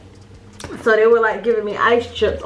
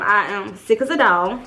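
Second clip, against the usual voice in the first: ordinary talk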